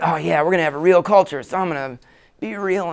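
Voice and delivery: a lightly voiced, eager, self-satisfied pastor; mock fake sincerity. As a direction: parody the pastor who announces he'll be real. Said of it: "you're like".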